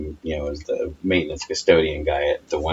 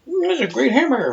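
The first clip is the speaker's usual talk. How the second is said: much higher dreamy mom voice; sleep-talking mom. Retold or imitated. Imitating his sleep-talking mom, much higher. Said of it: "starts going".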